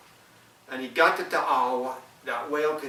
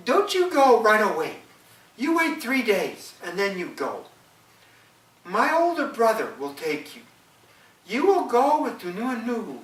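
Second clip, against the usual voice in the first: wife, higher and louder, slowing at times; the wife telling her husband to wait, higher voice; 7 semitones higher, 5 dB louder than usual. Acted. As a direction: warn him high and firm as the wife. Wife voiced in folk tale.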